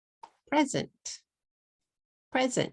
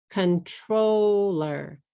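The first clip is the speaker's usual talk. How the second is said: "Controller" stretched out slowly in three English syllables; 3 dB louder, much slower than usual. Shown as a demonstration. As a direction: say "Controller" very slowly, con-tro-ller. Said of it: "How many syllables do you hear?".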